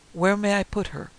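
impatient, clipped command; snapped. A narrator's reading. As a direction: bark the question at the servant. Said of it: "he snapped at the anxious servant".

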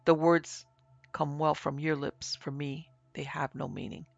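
ordinary delivery

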